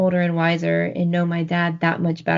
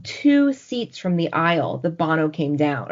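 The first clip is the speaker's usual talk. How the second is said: usual speaking voice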